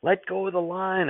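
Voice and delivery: put-on boy warning his grandfather, high urgent shout. A narrator's reading. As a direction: cry out a warning over the noise. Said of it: "I shouted".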